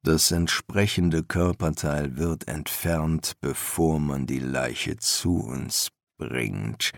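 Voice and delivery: low, slow, patient master; patient old teacher's voice; patient explanation. A narrator's reading. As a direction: explain it slowly and softly, pitched low. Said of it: "erklärte er geduldig".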